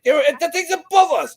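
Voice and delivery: very high squealed mock fright; imitated alarm. Passengers pointing at the tanker overhead, hammed-up alarm. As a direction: keep the pitch way up, as if panicking. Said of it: "see people's faces like".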